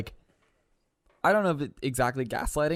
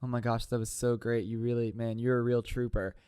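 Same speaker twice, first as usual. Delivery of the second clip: imitated soothing sympathy; 5 semitones lower, 5 dB quieter than usual. Voicing the Bachelor's sympathy in retelling.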